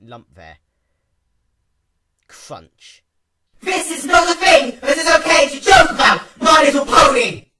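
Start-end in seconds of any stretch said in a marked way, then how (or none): 3.6-7.5 much higher, much louder booming shout; royal boom. A narrator's reading. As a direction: thunder it like a newly crowned princess. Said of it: "Twilight boomed in her newfound royal voice".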